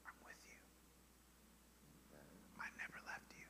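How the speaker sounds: hushed, barely-there whisper. Acted. God to the listener in a still small voice, whispered. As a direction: whisper it very softly. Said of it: "he whispers just a small little whisper".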